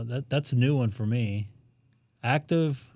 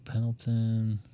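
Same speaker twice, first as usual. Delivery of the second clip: ordinary delivery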